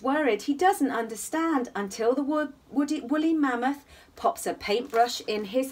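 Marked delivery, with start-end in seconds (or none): none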